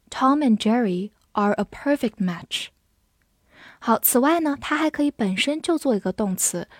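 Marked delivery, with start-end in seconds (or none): none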